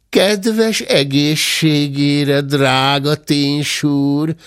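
high, gloating glutton savouring every dish; greedy old bear. Acted. Uncle Hörpentő praising the stolen dinner, greedy and gleeful.

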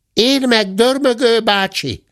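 high-pitched little cub voice; bear cub. A narrator's reading. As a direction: pipe up from behind the door. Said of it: "hangzott a bocs válasza".

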